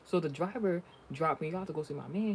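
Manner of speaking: ordinary talk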